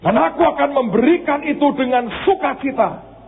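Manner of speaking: high, ringing preacher's voice; a pastor lifting his voice over the church; fervent preaching. Acted. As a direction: preach it out to the congregation. God's words, preached.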